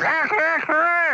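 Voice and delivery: high, loud mock rooster crow echoing the first; young rooster crowing. Joke teller crows as young rooster following old one. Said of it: "the young one goes".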